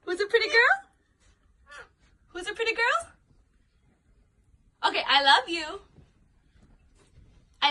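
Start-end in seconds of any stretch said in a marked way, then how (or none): 0.0-3.1 high sing-song coaxing the bird; teaching a parrot. Real, not acted. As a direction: coo it up high. Owner coaching pet parrot.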